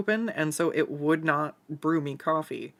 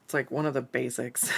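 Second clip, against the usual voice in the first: ordinary delivery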